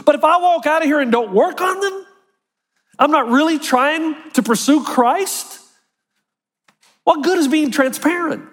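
ordinary talk, nothing marked

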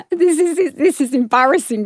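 embarrassed laugh through the words; giggling at her own embarrassment; laughing at herself. Retold or imitated. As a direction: laugh it off while you say it. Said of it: "And I thought".

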